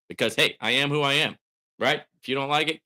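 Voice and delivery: ordinary delivery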